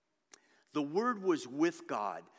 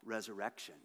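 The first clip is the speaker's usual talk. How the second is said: ordinary delivery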